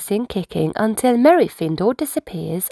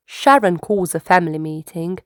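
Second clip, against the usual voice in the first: plain reading voice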